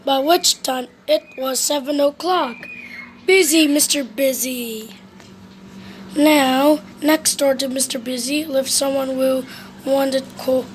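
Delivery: ordinary delivery, nothing marked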